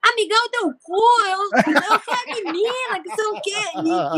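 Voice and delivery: imitating someone taking offence, high and drawn out; high-pitched, slow mock indignation; mock offended. Mistaken-for-a-boy customer snapping back at pump attendant, mock offended. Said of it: "E, e acha ruim".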